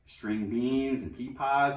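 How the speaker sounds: ordinary delivery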